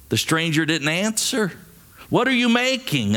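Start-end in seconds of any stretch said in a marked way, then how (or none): 2.1-3.2 high, urgent question without real volume; mild narrated shout. A narrator's reading. Cave dweller pressing the silent stranger, mild shout. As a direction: call it out high, as a restrained shout. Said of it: "another shouted even louder".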